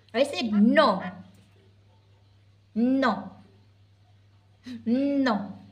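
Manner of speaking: loud, emphatic, separated noes; firm refusal. Real, not acted. Repeats a firm no to baby. (kusog ug lig-on nga pagbalibad)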